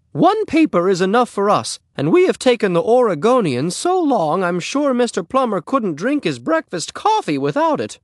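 high, fussy neighbor-lady voice; Missus Plummer. Acted. Neighbor refuses a kitten from the boy, high and fussy. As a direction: pitch it way up and protest.

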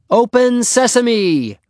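loud, high drawn-out yell. A narrator's reading. Trapped Henry yells a magic word at the door, yelling. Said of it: "yelled Henry".

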